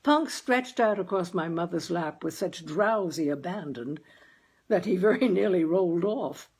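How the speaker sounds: ordinary delivery, nothing marked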